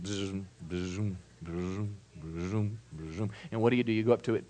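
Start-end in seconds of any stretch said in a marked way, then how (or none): 0.0-3.3 low, soft buzzing noise; stuck record buzz. Retold or imitated. Preacher imitating a stuck record player with a soft buzz. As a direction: imitate a needle skipping on a record. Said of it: "it just goes".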